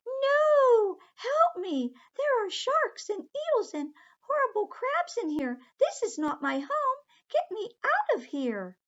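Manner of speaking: very high, loud, near-sobbing cries; panicked crying. Acted. Aqua in the sea with sharks and eels, crying for help. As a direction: shriek in panic between sobs.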